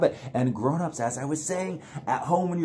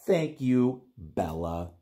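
normal talking voice

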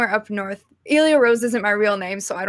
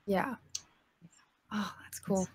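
whispered aside; 13 dB quieter, slower than usual. Real, not acted. Reacting quietly to the guest.

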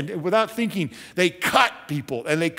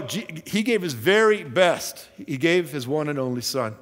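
ordinary talk

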